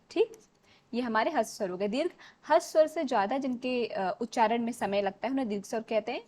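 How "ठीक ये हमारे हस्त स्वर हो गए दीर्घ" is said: ordinary talk, nothing marked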